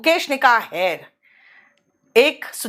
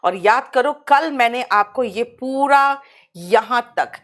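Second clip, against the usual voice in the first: normal talking voice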